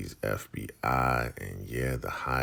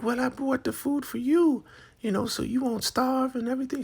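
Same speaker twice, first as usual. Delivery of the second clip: very high, sugary put-on voice; sweet helper; 4 dB louder, faster than usual. Woman bringing food to Marva, very high and sweet. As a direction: offer kindly. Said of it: "She's like".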